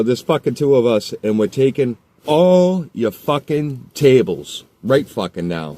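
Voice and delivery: loud, high, slow threatening shout; angry threat. Retold or imitated. Threatening the kid with his buddy, slow loud shout. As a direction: lay down the law at full volume. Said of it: "I go".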